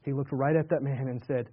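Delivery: ordinary delivery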